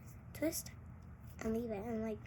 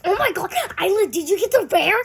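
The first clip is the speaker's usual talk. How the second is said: higher, much louder, amazed squeal; excited squeal; 7 semitones higher, 16 dB louder than usual. Pretend. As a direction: gasp it out like you just saw treasure. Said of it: "and she's like".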